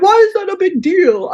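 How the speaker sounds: high, puzzled mock voice of the parents; mock parents' voice. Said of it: "They're like".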